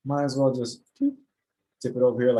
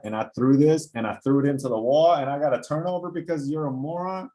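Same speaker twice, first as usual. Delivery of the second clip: louder, higher, venting about a teammate's blown cut; real frustration; 6 semitones higher, 3 dB louder than usual. Real, not acted. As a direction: rant at the player who didn't finish his cut. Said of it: "I'm getting mad".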